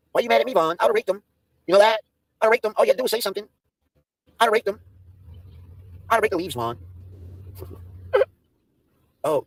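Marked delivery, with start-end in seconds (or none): 0.1-6.8 higher, whiny plea of innocence; mock innocent whine. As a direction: play the wrongly accused. Teasing Vaughn about raking.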